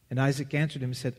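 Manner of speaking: ordinary talk, nothing marked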